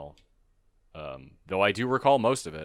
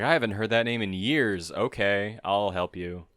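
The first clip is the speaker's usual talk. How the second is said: ordinary delivery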